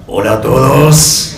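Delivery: loud rally greeting to a crowd; booming balcony speech. Pretend. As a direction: boom it out like a politician at a rally. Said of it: "en un típico acto de, de Argentina diría".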